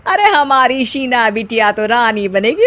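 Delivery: proud aunt voice, Rani said at normal pitch; gossiping aunt. Acted. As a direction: boast about the bride as an aunt. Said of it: "बाहर शीना की बुआ किसी से बात करती है". (शेखी बघारती बुआ, रानी सामान्य ढंग से)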